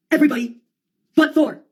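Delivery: theatrical outburst; emphatic exclamation. Acted. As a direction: call it out high and emphatic. Emphatic punchline, then repeated lower.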